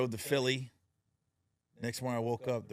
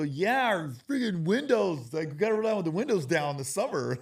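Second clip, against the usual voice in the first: put-on kids complaining; 8 semitones higher, 8 dB louder than usual. Kids explain the hot car, whiny complaint. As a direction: gripe like a kid stuck in a hot car. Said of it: "They go".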